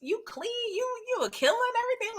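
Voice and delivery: hammed-up smitten fan voice; mock swoon. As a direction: swoon over him like a fangirl. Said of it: "I'm like".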